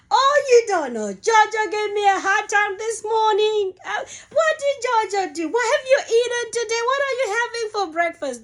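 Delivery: high, lively teasing aunties' voice; playful, fast cafeteria chatter; imitating the aunties. Cafeteria aunties teasing the mother about her son, high and playful.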